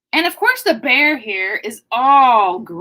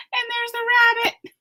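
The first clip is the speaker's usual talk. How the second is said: usual speaking voice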